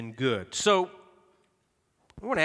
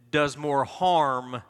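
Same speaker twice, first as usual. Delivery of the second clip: does more harm drawn out slowly; slow stress on harm; much slower than usual. Acted. Pastor to congregation, slow emphatic harm. Preacher stressing survey result.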